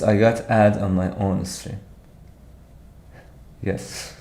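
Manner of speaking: ordinary delivery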